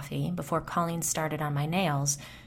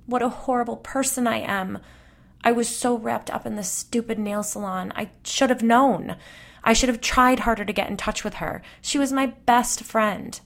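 higher, louder sobbing lament; wailing; 6 semitones higher, 5 dB louder than usual. Acted. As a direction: cry out the guilt. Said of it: "Colleen wailed".

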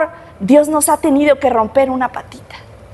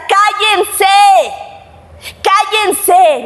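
higher-pitched, restrained crowd yell; mock shout; 4 semitones higher, much slower than usual. A narrator's reading. Crowd silencing two blind men, restrained shouting. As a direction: call out a silencing command, mildly. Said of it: "la multitud empezó a gritar".

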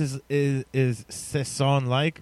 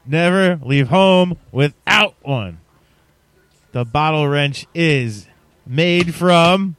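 toy commercial; 4 semitones higher, 6 dB louder, slower than usual. Pretend. Mock product ad.

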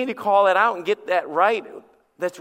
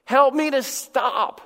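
high, very slow plea; pleading prayer; 5 semitones higher, 4 dB louder, much slower than usual. Acted. Sinner asking God for help, pleading prayer. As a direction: stretch out a heartfelt appeal, pitched up.

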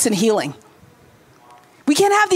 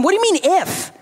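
a higher "What do you mean if?" pressing on "mean"; incredulous Jesus; 4 semitones higher than usual. Acted. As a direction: challenge the doubt in disbelief. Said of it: "But Jesus said to him".

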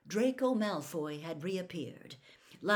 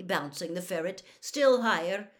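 usual speaking voice

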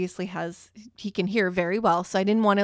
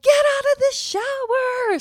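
much higher and louder, a dramatic panicked order; frantic shout; 6 dB louder than usual. Retold or imitated. To her husband in the shower, frantic loud panic. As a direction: yell it like the house is on fire. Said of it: "and I'm like".

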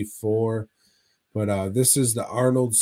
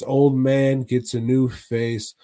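ordinary talk, nothing marked

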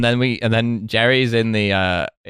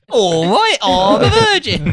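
loud, high-pitched comedian impression; drawn-out, overblown announcement in a high put-on voice; James Acaster; 12 semitones higher, 5 dB louder, slower than usual. Podcast host mimics a comedian declaring his virginity, high loud put-on voice. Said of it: "I'm just doing James Acaster".

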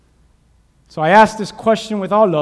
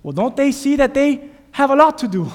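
high, incredulous scoff; scoffing onlooker; 9 semitones higher, 4 dB louder than usual. Retold or imitated. Preacher voicing the world answering back, high scoff. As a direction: pitch it up in disbelief. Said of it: "And they will say".

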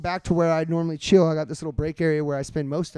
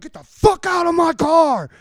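slow, high, furious reenacted shout; full-volume yell; 11 semitones higher, 6 dB louder than usual. Storyteller yelling at man getting in his car, furious shout. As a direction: yell it as loud as you can. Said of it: "full fucking loud as I could get".

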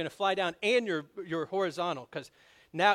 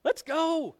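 much higher, loud, thrilled shout; eager missionary; 8 semitones higher, 5 dB louder, slower than usual. Retold or imitated. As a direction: burst out like you can't wait to go. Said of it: "are like".

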